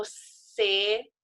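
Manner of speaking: CELULAR split slowly into ce, lu, lar; "celular" in three separate beats; syllable by syllable. Shown as a demonstration. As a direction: sound out "celular" with long gaps. Teacher sounding out syllables.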